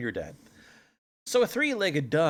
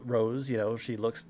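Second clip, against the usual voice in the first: usual speaking voice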